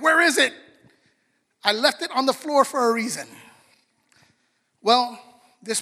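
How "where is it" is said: high and loud panicked search for the lost thing; frantic kid searching. Retold or imitated. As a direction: play the kid who can't find his stuff. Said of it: "and you're like".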